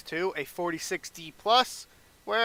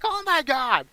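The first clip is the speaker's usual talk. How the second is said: excited; 9 semitones higher, 4 dB louder, slower than usual. Retold or imitated. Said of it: "Jim Ross and J R are like".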